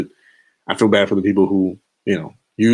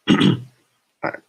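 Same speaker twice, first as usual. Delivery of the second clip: ordinary delivery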